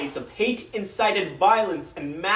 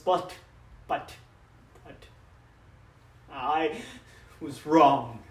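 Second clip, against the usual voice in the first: halting, lower, regretful confession; faltering admission; 4 semitones lower, slower than usual. Acted. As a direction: break off, then admit it slowly. Father's denial gives way to admission.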